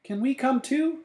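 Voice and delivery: high-pitched pleading question; little high childlike request; child character's voice. A narrator's reading. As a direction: ask high and sweet, like a little kid. Stella asks to join.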